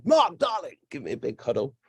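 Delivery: put-on delighted cry; gushing greeting. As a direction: mimic an effusive darling hello. Acted gushing greeting from a woman.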